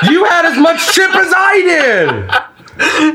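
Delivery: high, slow laughing yell; laughing shout. Real, not acted. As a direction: yell it while cracking up.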